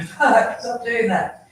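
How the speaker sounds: loud exasperated call to the dog; calling from far away. Retold or imitated. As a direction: scold the dog from across the yard. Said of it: "calling him in the yard".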